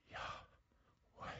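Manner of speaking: voiceless, all breath, barely audible; breathed name. Shown as a demonstration. Pastor to congregation, showing God's name as a breath. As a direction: let the name come out as a pure breath. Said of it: "our very first breath calls out the name of God".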